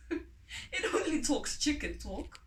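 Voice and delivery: slow words through a laugh; chuckling. Acted. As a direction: scoff at the idea while laughing. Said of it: "But the farmer chuckled".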